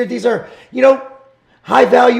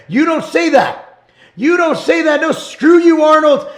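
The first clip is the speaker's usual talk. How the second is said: high, raised-voice scolding; angry rebuke; 6 semitones higher than usual. Real, not acted. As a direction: tell him off with real outrage. Angry rebuke addressed to Arnold.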